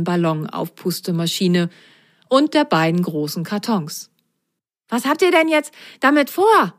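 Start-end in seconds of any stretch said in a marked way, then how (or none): 4.9-6.7 high, eager bunny question; the stuffed rabbit quizzes the socks, curious; rabbit asking. A narrator's reading. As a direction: ask with wide-eyed interest. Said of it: "fragte der Stoffhase".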